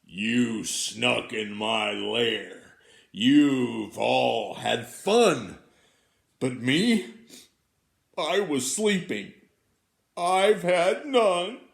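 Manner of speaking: big, slow, loud grumbling bear voice; a grumpy bear growling at the friends who snuck in. Acted. Bear complaining to his friends, slow and loud.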